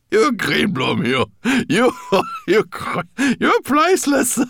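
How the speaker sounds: high, loud, laughing through the words; crying out amid laughter. Acted. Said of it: "he cried".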